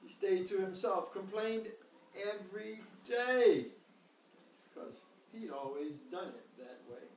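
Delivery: gentle storybook reading; reading to a toddler. A narrator's reading. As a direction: read it like a bedtime story. Reads the picture book aloud.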